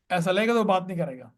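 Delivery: usual speaking voice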